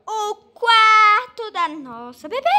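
big high excited fanfare; grand reveal. Real, not acted. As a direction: announce it like unveiling a surprise. Grand reveal of the baby's room. (grande revelação animada)

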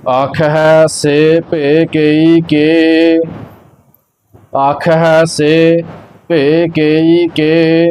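slow, measured sacred recitation; scripture recitation. Acted. Teacher reciting Gurbani for learners, slow measured chant-like reading. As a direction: recite evenly, drawing out the line endings.

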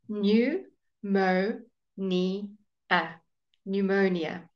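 "Pneumonia" as new, moh, nee, uh, with gaps; syllable by syllable. Shown as a demonstration. Teacher models pneumonia for learners, syllable by syllable.

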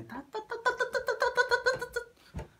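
rapid-fire "ta" noises; rapid slapping noise. Retold or imitated. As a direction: imitate fast repeated slaps with the mouth. Imitates the noise of the act.

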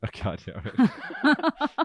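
real laugh through the words; exasperated, high-pitched, laughing. Real, not acted. Caught off guard, laughing.

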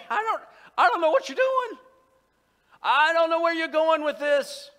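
high, loud plea to God; vulnerable outcry. Acted. Believer admitting confusion to God, loud and high. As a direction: wail your confusion at full voice. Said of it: "it's all right to be vulnerable".

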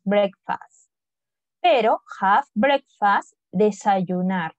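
normal talking voice

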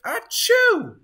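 big high loud sneeze. Acted. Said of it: "The snowman sneezed a gigantic".

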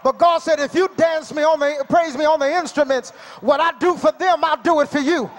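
ordinary talk, nothing marked

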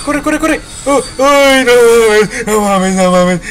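very high, loud frantic shrieks; panicked screaming. Real, not acted. Player chased right at the exit, panicked screaming. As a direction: scream in real panic while fleeing.

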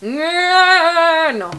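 hammed-up villain laugh, loud and high; loud put-on cackle then a high no; fail laugh. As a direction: force a big evil laugh that flops. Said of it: "that's a fail laugh".

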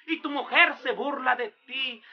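ordinary delivery

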